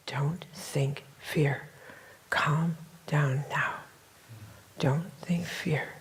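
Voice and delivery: slow hushed whisper of an inner voice. Retold or imitated. Inner voice whispering to her in the riot. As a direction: breathe it like a voice in her ear. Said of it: "And I heard a voice".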